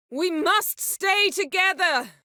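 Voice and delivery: high, slow urgent cry; drawn-out pleading shout; urgent call. Acted. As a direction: call the group to stay close.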